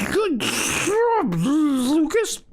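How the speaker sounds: a tiny high squeak of praise; squeaky butthole voice. Acted. Teacher's butthole praising Lucas in a tiny squeaky voice. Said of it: "it said".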